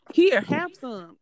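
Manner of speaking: high, slow and loud coworker offer; mock-eager coworker pushing the cup; pushy coworker. Coworker offering coffee in retold scene.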